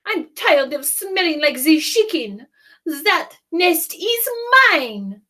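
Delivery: French duck voice. Acted. Duck's voice.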